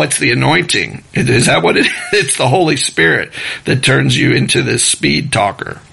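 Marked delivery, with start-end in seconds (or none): none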